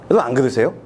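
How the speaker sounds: ordinary delivery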